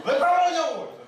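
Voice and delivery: loud, high, raging shout; furious yell. Pretend. An imagined Jesus yelling at Peter, a loud mock rage. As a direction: yell at the traitor, laughing through it.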